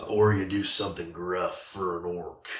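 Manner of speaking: lightly gruff orc voice; a half-hearted orc growl on the words; a hint of a gruff orc, barely put on. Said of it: "That was a terrible impression of an orc".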